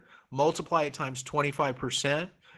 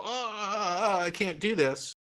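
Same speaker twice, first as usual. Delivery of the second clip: high, halting imitation of a quitter; mock defeated student; 6 semitones higher than usual. Teacher mimicking a student giving up, high and halting. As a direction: stammer it out like someone about to give up. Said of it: "If you give up and go".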